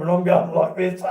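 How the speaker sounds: ordinary talk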